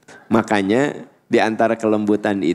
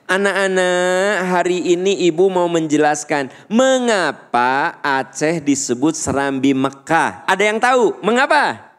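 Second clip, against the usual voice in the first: projected teacher voice; 7 semitones higher than usual. Shown as a demonstration. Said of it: "suara kita tuh kudu lantang".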